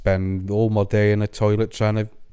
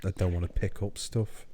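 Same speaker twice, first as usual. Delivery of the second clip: soft, low, reluctant protest; reluctant grumble; 4 semitones lower, 11 dB quieter than usual. Acted. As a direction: say it small and low, unwilling. Mumbled reluctant confession.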